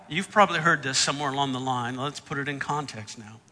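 ordinary talk, nothing marked

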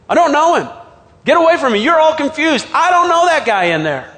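high-pitched, agitated protest; vehement denial. Acted. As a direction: push them away with insistent denials. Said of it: "began to call down curses".

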